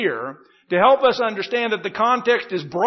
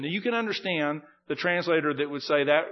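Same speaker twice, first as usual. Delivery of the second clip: normal talking voice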